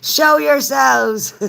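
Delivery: loud, high, stretched-out holler; slow high call across the room. Real, not acted. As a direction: summon them with a long shout. Calling out to hidden viewers.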